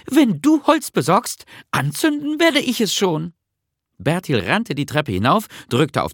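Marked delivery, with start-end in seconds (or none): 0.1-3.3 high, animated character voice; eager little Nisse. Acted. Nisse promises Bertil to light the fire, eager. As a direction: offer help in a much higher pitch.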